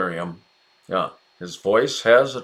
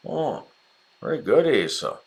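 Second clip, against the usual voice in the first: ordinary talk, nothing marked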